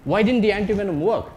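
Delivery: ordinary talk, nothing marked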